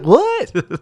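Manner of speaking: one high, drawn-out baffled word; imitated confusion. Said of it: "you're like".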